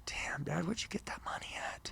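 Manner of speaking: retold half-whispered amazement; hushed awe. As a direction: drop to a very soft, near-whispered aside to Dad. Retold awed aside to his dad.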